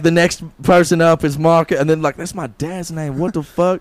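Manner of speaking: high shocked son in the story; acted announcer and son. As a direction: call out the next fighter then react.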